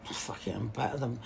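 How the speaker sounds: whispered curse under the breath; whispered aside. Real, not acted. Muttering an aside to herself, whispered. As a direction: drop to a whisper.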